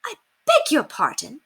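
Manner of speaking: high, taken-aback lady; surprised mistress; stunned. Acted. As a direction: caught off guard. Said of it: "Lady Constance looked stunned".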